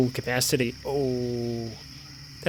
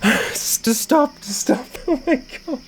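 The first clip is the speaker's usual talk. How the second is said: shaken; 8 semitones higher, 6 dB louder, slower than usual. Real, not acted. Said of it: "My heart's going".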